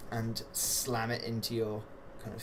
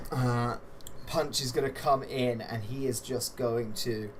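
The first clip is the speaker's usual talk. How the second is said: ordinary talk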